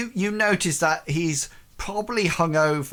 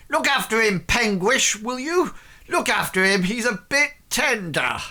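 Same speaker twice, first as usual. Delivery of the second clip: higher, louder call across the yard; shouting after; 8 semitones higher, 3 dB louder than usual. Acted. Reginald shouts to Hengist to care for Ashton, loud and high. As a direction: call out to the departing party. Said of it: "shouts out and says".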